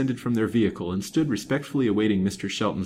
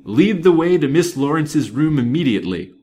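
loud, high imperious command; detective taking charge, loudly; 6 semitones higher, 8 dB louder than usual. A narrator's reading. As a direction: order the servant to obey at once. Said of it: "said Mister Shelton imperiously".

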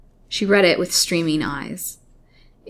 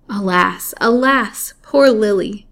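rising, louder melodramatic lament; 4 semitones higher, 7 dB louder, slower than usual. A narrator's reading. Narrator pities poor Lily's plight, melodramatic lament. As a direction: lament for her, building on each alas.

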